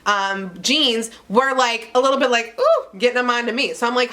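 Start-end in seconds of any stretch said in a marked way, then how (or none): 2.6-3.0 a high squeaky ooh; strained squeak. Retold or imitated. She squeaks at tight underwear, high and strained.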